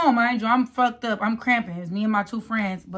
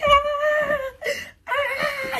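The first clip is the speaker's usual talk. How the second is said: high, strained cries of labor pain. Retold or imitated. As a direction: wail it like a contraction hits. Pregnant, crying out in the car.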